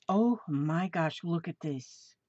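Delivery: normal talking voice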